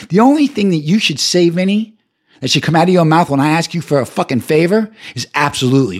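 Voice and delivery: usual speaking voice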